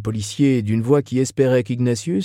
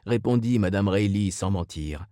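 ordinary delivery, nothing marked

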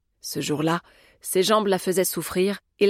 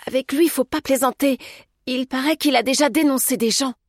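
higher, anxious confiding; timid, raised-pitch warning; fearful warning; 7 semitones higher than usual. A narrator's reading. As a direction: caution him with worry in the voice. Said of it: "dit-elle avec crainte".

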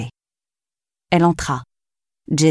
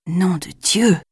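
a lightly voiced startled exclamation; half-voiced oath of surprise; mild exclamation; slower than usual. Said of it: "s'exclama Jason". (exclamation surprise, voix normale)

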